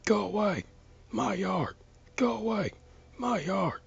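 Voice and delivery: high, loud repeated scream. A narrator's reading.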